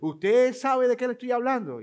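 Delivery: high, loud, eager sales pitch; imitating a saleswoman pressing for attention; hammed-up telemarketer checking you listen; pushy sales question. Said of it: "se acordaba que estaba hablando con alguien".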